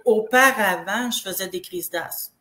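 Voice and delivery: normal talking voice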